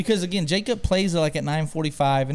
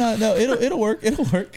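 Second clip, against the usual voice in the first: higher, mock-reassuring friend; retold insistence; 7 semitones higher, 3 dB louder than usual. Guest assuring his host about the blanket, insistent. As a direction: wave off the worry, pitched up.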